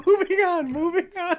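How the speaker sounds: high, laughing. Real, not acted. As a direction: say it while still laughing. Still laughing after slip.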